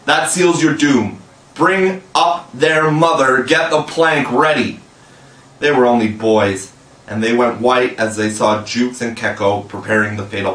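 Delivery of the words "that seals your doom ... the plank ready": a touch of a raging captain; roaring. A narrator's reading. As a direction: roar out the sentence. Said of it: "and Hook roared out".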